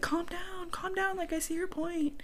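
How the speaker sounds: soft, high-pitched mock soothing; pacify an angry partner in a small high voice; placating. Acted reply to an angry rant.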